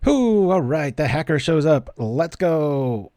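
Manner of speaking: excited; pumped. Real, not acted. Excited at card showing up.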